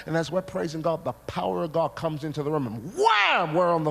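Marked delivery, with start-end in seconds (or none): 2.9-3.5 loud, high impact noise; sudden shouted wham; explosive "wham". Retold or imitated. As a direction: burst out the crash word. Imitates power hitting the room.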